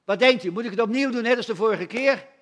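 a hint of anxious pleading; David asking God. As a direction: ask God what to do, keen and urgent. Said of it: "En die zei".

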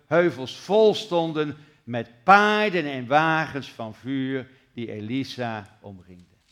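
ordinary delivery

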